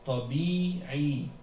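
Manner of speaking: Tabi'i drawn out, both i sounds held; two long vowels. Shown as a demonstration. Teacher shows two meds, Tabi'i with both vowels lengthened. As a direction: hold each i long in Tabi'i. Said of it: "deux meds".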